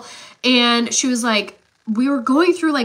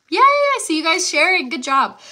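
excited; 6 semitones higher, 4 dB louder than usual. Real, not acted. Cheering on viewers sharing.

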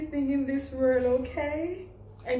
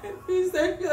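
laughing through tears; 7 semitones higher than usual. Real, not acted. Overwhelmed mother-to-be, laughing crying.

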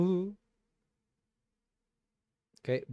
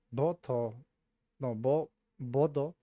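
ordinary talk, nothing marked

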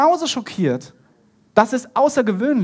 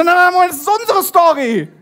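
high, loud objection; indignant protest; 9 semitones higher, 6 dB louder than usual. Acted. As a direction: cut in shouting that it's yours to tell. Acted protest at having news stolen.

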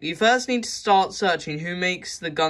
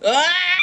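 scream; 11 semitones higher, 5 dB louder than usual. Acted.

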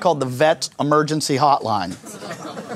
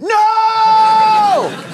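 high loud no; shout; 5 dB louder than usual. Retold or imitated. Owner yelling at his pooping dog, full shout. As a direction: yell it out involuntarily. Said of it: "out loud, but I went".

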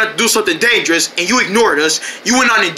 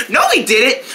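ordinary talk

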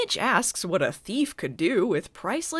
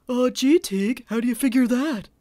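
dopey mock voice; 4 dB louder than usual. Mocking Mitch's dumb question.

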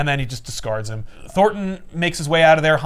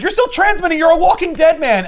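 very high, loud mocking villain; high-pitched gloating villain; shrieking taunt; 7 dB louder than usual. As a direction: crow at him in triumph.

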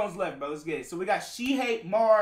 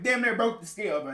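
usual speaking voice

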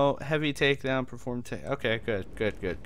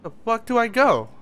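being chased; 8 semitones higher, 4 dB louder than usual. Real, not acted.

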